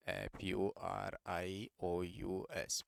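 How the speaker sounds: patient letter-by-letter spelling; spelling out slowly. Shown as a demonstration. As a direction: spell it out for the students. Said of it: "fast and furious".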